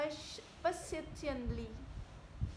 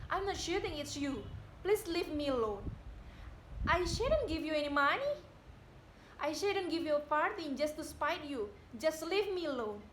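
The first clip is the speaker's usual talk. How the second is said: loud, heated refusal, voice raised; angry outburst; 3 dB louder than usual. Acted. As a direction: push him away with anger. Widow tells off visitor.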